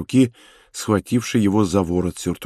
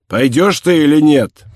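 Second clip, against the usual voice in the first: loud, raised-pitch angry demand; shouted demand; 5 semitones higher, 6 dB louder than usual. A narrator's reading. Inspector shouts at his prisoner to move, angry shout. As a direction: shout it as an impatient order. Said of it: "и закричал".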